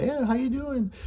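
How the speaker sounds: high, squeaky cracked-voice imitation; cracking voice. Waiter greeting tables, voice cracking high. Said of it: "My voice is always cracking".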